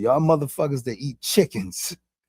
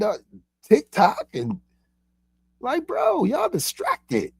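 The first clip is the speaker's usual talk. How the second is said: higher, urgent voice of one sent to wake his brothers; a raised preacher-like call to wake up; higher pitched wake-up call; awakener voice; 10 semitones higher than usual. Acted. Speaks as awakener to humanity.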